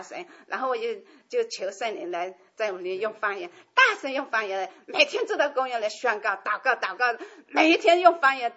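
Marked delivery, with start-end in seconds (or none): none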